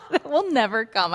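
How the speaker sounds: amused, laughing disbelief. Real, not acted. Teasing a friend about putting things off, laughing.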